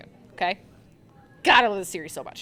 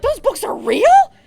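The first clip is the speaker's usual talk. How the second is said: much higher, louder, slow, stunned disbelief; astonished; 12 dB louder, slower than usual. Retold or imitated. The heroes reacting to the demon, hammed-up astonishment. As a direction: gasp it out as if the books were real.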